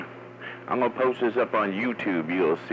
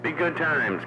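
higher repeat; 7 semitones higher, slower than usual. Real, not acted. Trucker signing off to Tank, higher sing-song repeat. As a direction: lift it higher the second time.

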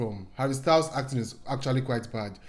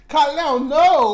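hammed-up dramatic cry, higher and louder; Gal Gadot line; 10 semitones higher, 8 dB louder, much slower than usual. Reactor comparing stiff acting, hammed-up mock plea. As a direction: overdo a flat dramatic plea. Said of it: "Like, um, Gal Gadot".

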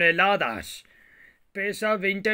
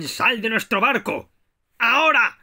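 pirate order; 5 semitones higher, 5 dB louder than usual. Pretend. Pirate orders enemy off ship.

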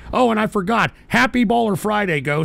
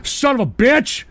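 ordinary talk

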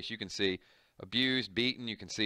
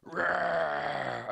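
high growling zombie roar; 5 semitones higher than usual. Acted. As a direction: roar like a snarling zombie girl. Zombie daughter attacks.

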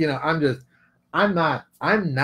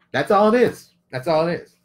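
usual speaking voice

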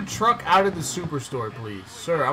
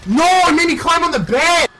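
very high, loud furious shout; raging gamer; 13 semitones higher, 10 dB louder than usual. Real, not acted. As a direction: scream in frustration. Raging at game glitch.

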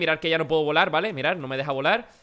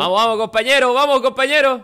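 loud cheering; 7 semitones higher, 8 dB louder than usual. Real, not acted. Cheering friend's bucket catch.

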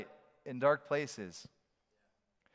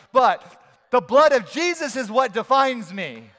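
louder, higher, driving preaching; preaching at full force; 6 semitones higher, 8 dB louder than usual. Acted. Preacher closing the sermon over music, full force. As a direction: build the sermon toward its close with fire.

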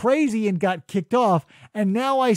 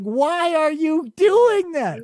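high, slow yell at the screen; high, stretched-out frustrated holler; exasperated shout; 9 semitones higher, 4 dB louder, slower than usual. Acted. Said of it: "anybody would be shouting at the screen".

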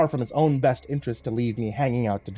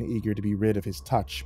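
ordinary delivery, nothing marked

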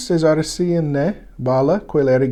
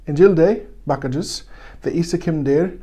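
ordinary talk

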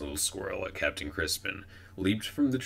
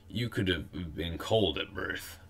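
ordinary delivery, nothing marked

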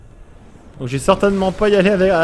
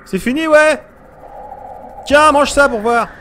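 high, loud slow jeer; mocking taunt, drawn out; 9 semitones higher, 4 dB louder, slower than usual. Real, not acted. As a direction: gloat at the monster. Taunting a defeated monster.

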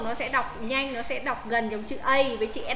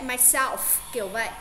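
usual speaking voice

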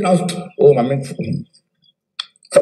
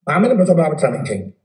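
usual speaking voice

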